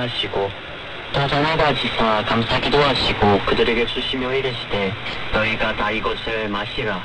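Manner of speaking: usual speaking voice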